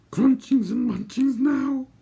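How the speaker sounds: high, slow creature voice, pressing; Gurgi's suggestion. A narrator's reading. Gurgi pressing for food on the ridge, suggesting. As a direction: urge it in a high creature squeal. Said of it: "Gurgi suggested".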